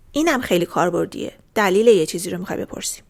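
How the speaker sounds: ordinary talk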